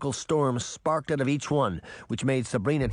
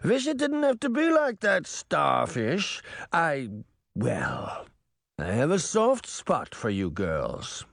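mock-tender villain; 3 semitones higher than usual. A narrator's reading. Mirror, the villain, speaking.

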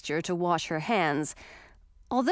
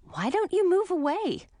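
put-on young princess voice; 11 semitones higher than usual. Princess asks.

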